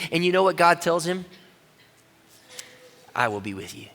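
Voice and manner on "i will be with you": much lower and softer, unhurried; deep, quiet divine promise; low, hushed voice of God. Acted. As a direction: answer calmly from above. God answers Moses.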